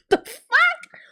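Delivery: high, crying-out disbelief; shocked outcry. Real, not acted. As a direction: let the shock crack out high. Reacting to Victor's choice.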